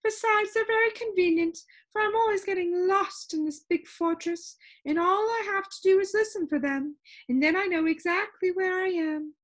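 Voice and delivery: a fussy, lilting lady with static in her; complaining sound keeper. Acted. Sound Keeper answering the visitor, high and complaining. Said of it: "she answered quickly".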